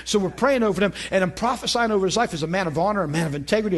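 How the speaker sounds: ordinary talk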